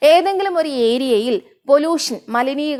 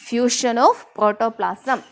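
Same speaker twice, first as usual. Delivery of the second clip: slow dictation; much slower than usual. Shown as a demonstration. Teacher dictating a definition.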